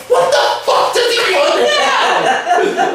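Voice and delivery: slowed-down, exasperated mock fury; exasperated anger. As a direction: spit out the annoyance while laughing. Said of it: "I was just so angry".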